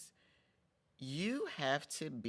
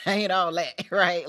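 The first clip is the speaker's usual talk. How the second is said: louder, laughing through "I ain't all that"; 6 semitones higher, 10 dB louder than usual. Real, not acted. Vlogger laughing at her own ego, loud and amused. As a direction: let the laugh spill into the words.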